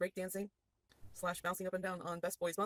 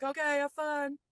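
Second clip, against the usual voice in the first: cheery call back; 7 semitones higher, 7 dB louder, slower than usual. Acted. [name] calling back cheerfully.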